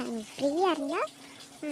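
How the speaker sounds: normal talking voice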